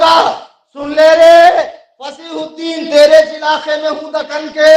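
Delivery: loud, high, forceful challenge to rivals; fiery preaching. Real, not acted. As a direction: thunder it out like a debating preacher. (जोशीली, ललकारती तक़रीर)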